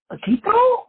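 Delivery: very high-pitched little query; questioning Spanish lady. Retold or imitated. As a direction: imitate her asking, voice shooting way up.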